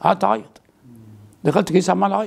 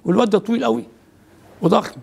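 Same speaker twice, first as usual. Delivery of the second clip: ordinary delivery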